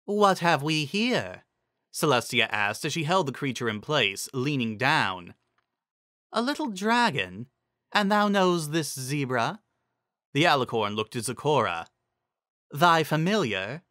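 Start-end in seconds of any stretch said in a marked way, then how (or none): 0.0-1.5 slow, higher lofty drawl; regal amusement. Acted. Celestia inspecting the dragon, slow and lofty. 6.3-13.8 regal amusement. Acted. Celestia to dragon.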